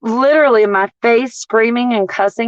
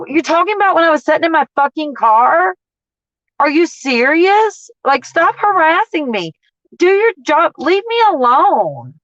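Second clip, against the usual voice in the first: retold angry retort; 7 semitones higher than usual. Retold confrontation with store manager.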